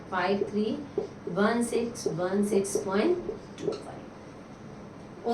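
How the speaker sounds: slow it down so the class can write each digit; slow dictation. Shown as a demonstration. Teacher repeats the answer slowly for students to copy. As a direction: spell out the digits slowly, one by one. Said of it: "teacher repeats the number slowly".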